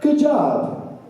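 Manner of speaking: high, cheery boss voice; upbeat mock boss praise; boss praising. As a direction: pat him on the back with your voice. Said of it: "pats you on the back and says".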